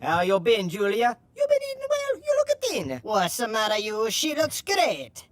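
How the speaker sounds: two barbers squabble over a razor, stage Italian; Italian barber accent. Acted. Barber accuses his partner about the razor, hammed-up Italian accent.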